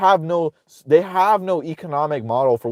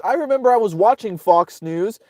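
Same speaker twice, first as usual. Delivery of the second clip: ordinary talk, nothing marked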